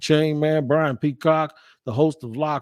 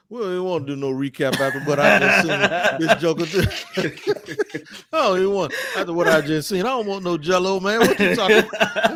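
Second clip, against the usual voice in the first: laughing; 5 semitones higher than usual. Real, not acted. Host cracks up at co-host.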